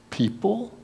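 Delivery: soft, high, questioning disbelief; incredulous. Acted. Preacher echoing Osteen's word in soft disbelief. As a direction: echo it in disbelief.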